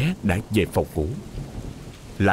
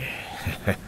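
low lecherous chuckle; 8 dB quieter than usual. A narrator's reading. Drunk master leering.

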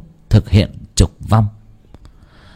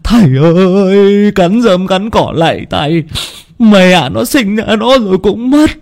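tearful pleading; 11 semitones higher than usual. A narrator's reading. Distraught father begs the shaman to save his son, tearful wailing. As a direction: beg desperately as if bawling. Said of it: "cứ ôm lấy con mà mếu máo".

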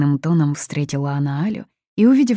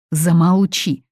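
loud, raised command; angry shout; 4 semitones higher, 7 dB louder than usual. A narrator's reading. Mother throws the guest out, angry shout. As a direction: order her out with a cry. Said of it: "крикнула Фатини".

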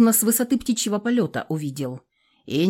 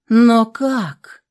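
worried; 5 semitones higher, 8 dB louder than usual. A narrator's reading. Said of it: "озабоченно поинтересовалась Олеся".

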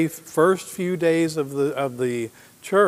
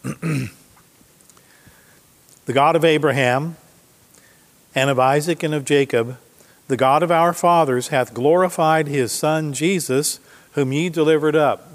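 a raised, declaiming Bible reading; scripture reading; 2 semitones higher, 4 dB louder than usual. Acted. Preacher reading Peter's words to the congregation, declaiming. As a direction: proclaim the verse to the congregation.

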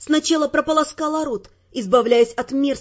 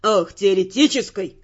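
beside himself; 7 semitones lower than usual. Acted. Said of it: "Тео был вне себя".

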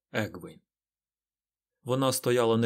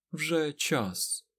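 higher, icily restrained; cold voice; 5 semitones higher, 3 dB quieter than usual. A narrator's reading. Aes Sedai tells the Forsaken it is time, coldly. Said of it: "голосом холодним, наче крига серед глибокої зими".

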